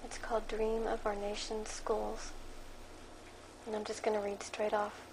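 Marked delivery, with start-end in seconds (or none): none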